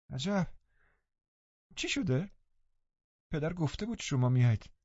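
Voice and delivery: put-on high, soft, breathless invalid boy; sickly boy. A narrator's reading. Linton to Catherine, high and frail. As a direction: shrink away, weak and fretful.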